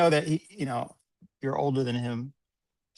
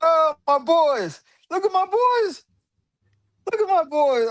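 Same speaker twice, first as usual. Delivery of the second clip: hammed-up proud boss; 9 semitones higher, slower than usual. Proud boss fawning over workers.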